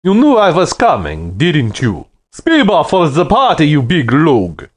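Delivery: a loud Russian bully thanking them for the party; booming Russian accent. A narrator's reading. Yuri mocking Stan at the party, booming Russian accent. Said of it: "his voice boomed like a backfiring car with a Russian accent".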